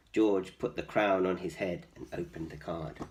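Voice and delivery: usual speaking voice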